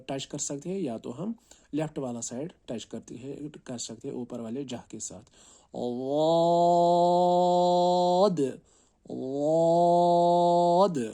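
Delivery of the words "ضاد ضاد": واد chanted long and thick, high and loud; teacher modelling a thick ضاد; heavy stretched letter. Shown as a demonstration. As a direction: recite ضاد heavy, no ز sound. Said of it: "زواد کو بھی کھینچ کے".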